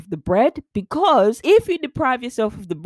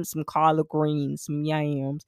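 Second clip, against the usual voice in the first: slow, lower mock Southern accent; attempted Southern accent; 5 semitones lower, 5 dB quieter, slower than usual. Retelling an accent attempt in New York, mock Southern. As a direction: imitate a botched Southern accent. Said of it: "It came out Southern".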